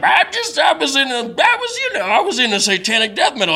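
high-pitched, stumbling caricature; shouting homeless man. Retold or imitated. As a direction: imitate a flustered man protesting in a high voice. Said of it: "they're just shouting at each other".

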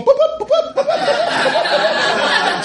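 very high, laughing boops like a remote alarm; mocking car alarm beeps. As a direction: chirp the alarm sound over and over, cracking up. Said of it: "standing outside all night going".

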